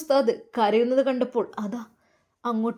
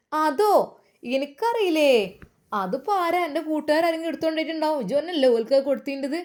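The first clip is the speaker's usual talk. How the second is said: dismissive mother; 6 semitones higher than usual. Acted. Mother brushing off son's question.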